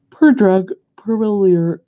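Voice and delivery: words stretched through a yawn. Real, not acted. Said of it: "Sorry, I'm yawning".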